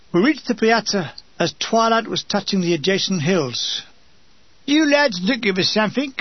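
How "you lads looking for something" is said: a light Cockney woman, pitched up; a hint of a shaky old Cockney lady calling out; lightly voiced wavery Cockney woman asking. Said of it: "A wavery female Cockney voice".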